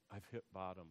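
low, hushed admission of defeat; retold despair. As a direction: drop low and soft, as if giving up. Retold moment of hitting rock bottom.

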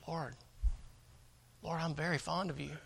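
soft, low, halting admission; acted hushed reply to the Lord; subdued Peter. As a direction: offer affection quietly and sheepishly, with pauses. Acting Peter's reluctant, lesser answer.

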